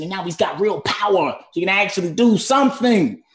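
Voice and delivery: rising, loud, high-pitched excitement; excited. Acted. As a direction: build to a thrilled exclamation. Voicing character's thrill at power.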